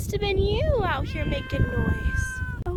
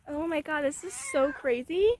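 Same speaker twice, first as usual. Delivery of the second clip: ordinary talk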